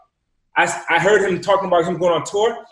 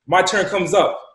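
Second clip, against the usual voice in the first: ordinary talk, nothing marked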